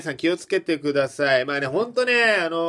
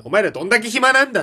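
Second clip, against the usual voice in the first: mock scolding; 6 semitones higher, 7 dB louder, faster than usual. Mock scolding idle workers.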